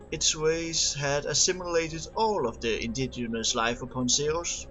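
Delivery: ordinary delivery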